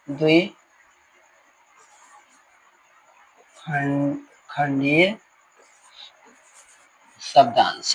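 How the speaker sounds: term spoken piece by piece with long pauses; slow dictation. Real, not acted. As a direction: dictate slowly as you write. Dictating a term while writing it. (धीरे-धीरे लिखते हुए बोलना)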